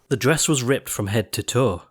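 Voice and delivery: ordinary delivery, nothing marked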